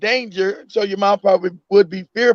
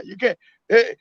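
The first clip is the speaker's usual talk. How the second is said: ordinary talk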